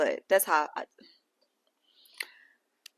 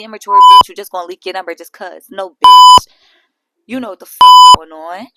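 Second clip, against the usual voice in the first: E T yelling, much louder, insults screamed; imitated furious rant; 16 dB louder than usual. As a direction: rant back with mounting fury. E T yelling back at Rolly.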